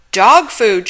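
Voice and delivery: shrieked loud enough to carry three aisles. Acted. Manager shrieking at Helen in the witch store, very loud. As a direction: blast it out in outraged disbelief. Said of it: "shrieked the manager in a voice".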